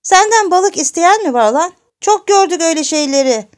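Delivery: high, loud angry outburst; furious granny. Acted. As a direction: lash out as an old woman losing her temper. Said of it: "Koca ninenin öfkesi başına sıçradı". (öfkesi tepesinde, bağıran koca nine)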